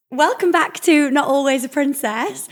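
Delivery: slow, bright show opener; podcast intro. Acted. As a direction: announce the show warmly.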